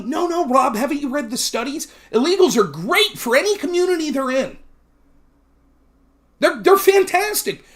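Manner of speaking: lightly mocking lefty voice; a touch of a smug debater lecturing. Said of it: "They all tell me".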